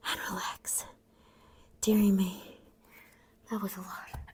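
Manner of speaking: breathless whisper. Real, not acted. Exhausted after plank set.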